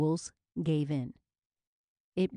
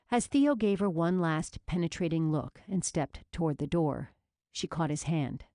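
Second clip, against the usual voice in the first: plain reading voice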